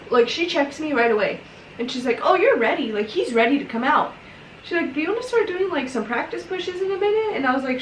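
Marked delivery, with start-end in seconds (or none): 2.2-7.4 nurse voice, pitched higher; chirpy encouraging nurse, lifted pitch; upbeat nurse. Retold or imitated. Nurse voiced in birth story.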